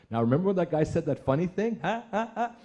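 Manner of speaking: high, hammed-up canned laughter; fake audience laugh. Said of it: "turn the cameras and go".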